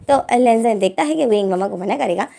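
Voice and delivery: plain reading voice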